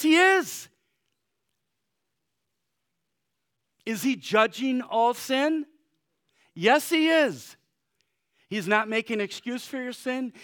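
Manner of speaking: full-force revival preaching; fiery preaching. Acted. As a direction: preach it to the back row.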